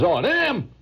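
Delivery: high-pitched scoffing question; dismissive scorn. Acted. Painter belittling his tourist turtles, high dismissive scoff.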